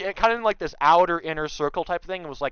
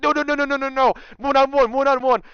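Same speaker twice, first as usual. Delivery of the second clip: loud, high, rapid-fire pleading; frantic high-pitched objection; panicked protest; 11 semitones higher, 7 dB louder than usual. Retold or imitated. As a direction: cry out in mock panic, repeating urgently. Retells panicked protest to admins.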